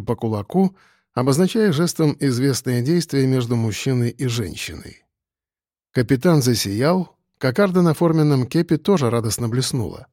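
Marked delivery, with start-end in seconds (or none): none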